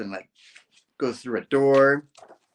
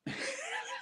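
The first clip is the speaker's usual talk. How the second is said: high giggle fit; high laugh; 15 dB quieter than usual. Real, not acted. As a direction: burst out laughing. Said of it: "Can't even talk".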